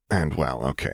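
ordinary delivery

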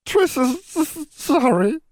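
very high elf voice, stammering through tears; a tiny elf choking out an apology; wailing house elf. A narrator's reading. Ashamed elf wailing an apology to Valeria. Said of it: "Triss wailed".

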